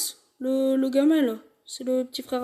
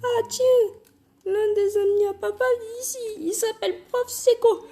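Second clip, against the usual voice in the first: nasty high-pitched mother voice; unpleasant mom voice; 8 semitones higher than usual. Acted. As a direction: play an annoying mom, pitched up. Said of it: "je vais faire une voix désagréable pour la daronne".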